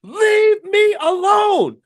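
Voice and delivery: high, drawn-out full shout; furious retold yell. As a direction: yell it slowly, every word stretched. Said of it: "And I yelled at him".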